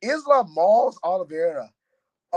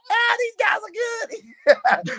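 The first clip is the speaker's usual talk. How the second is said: much higher, slow, wailing mock cry; mock worried whine; much slower than usual. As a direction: ham up his own nervous protest. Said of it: "I'm like".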